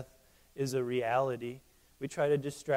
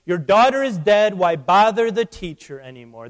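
booming, much louder and higher, as death itself; 3 semitones higher, 10 dB louder than usual. Acted. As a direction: announce the loss coldly, like death taunting. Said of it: "That's death speaking".

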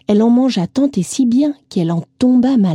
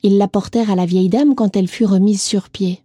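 ordinary delivery

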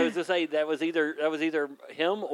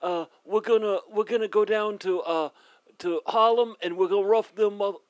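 put-on Brooklyn tough-guy accent; 4 semitones higher than usual. Player in an accent fight, Brooklyn accent threatening a rough-up. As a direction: swagger through it in mock Brooklyn.

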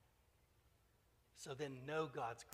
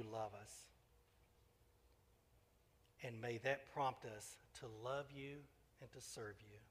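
closing prayer. Real, not acted. Pastor closes the sermon with a gentle spoken prayer.

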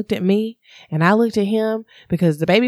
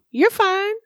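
higher, breezy imitation of family saying it's fine; mock cheerful reassurance; 6 semitones higher than usual. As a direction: lightly mimic relatives brushing it off.

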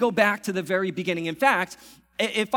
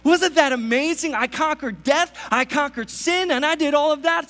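much higher, loud boastful showing off; mock bragging; 9 semitones higher, 5 dB louder than usual. Pastor imagining himself bragging after the resurrection, loud. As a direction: brag like you want applause. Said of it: "I'd say".

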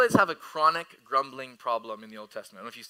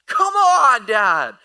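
loud, high-pitched protest shout imitating a son; angry kid; 9 semitones higher, 15 dB louder, much slower than usual. Son protesting to dad in the cereal aisle, mock-angry. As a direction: shout it like an exasperated child. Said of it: "Like, so angry".

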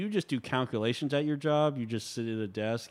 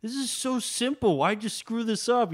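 higher, louder and slow, hammed-up telling-off; mock scolding; 11 semitones higher, 4 dB louder than usual. Host playing a boss scolding a company, hammed-up and slow. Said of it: "go to a company and go".

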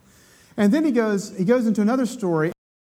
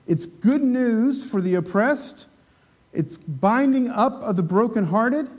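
normal talking voice

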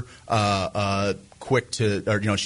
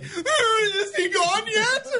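very high, drawn-out mock-hysterical squeal; hysterical laughing. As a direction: imitate a friend calling out in giggly hysterics. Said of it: "like, hysterically".

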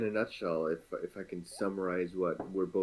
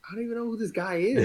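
high, fast, incredulous complaint; baffled exasperation; 13 semitones higher, 3 dB louder, much faster than usual. Retold or imitated. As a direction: act totally lost and fed up. Mock baffled reaction to game.

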